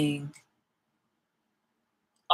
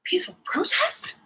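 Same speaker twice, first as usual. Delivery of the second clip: mocking whisper; 13 semitones higher than usual. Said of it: "It's just like".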